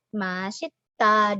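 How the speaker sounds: Masita said slowly with a clear s; slow Korean demo. Shown as a demonstration. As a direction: stretch out Masita for learners. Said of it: "S-nya tetap dibaca S". (ucapkan Masita perlahan, s jelas)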